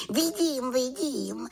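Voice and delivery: high-pitched little witch voice. A narrator's reading. Little witch answers the raven.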